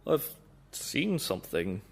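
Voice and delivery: Toby nervously breaking bad news; shaky voice. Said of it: "said Toby in a shaky voice".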